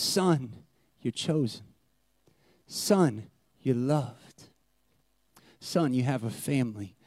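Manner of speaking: put-on kneel and comfort the boy; quiet kneeling reassurance; tender judge.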